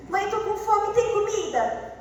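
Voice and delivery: put-on hungry child. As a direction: whine up to mom for food. Child voiced asking mother. (filho pedindo comida com voz aguda e alta)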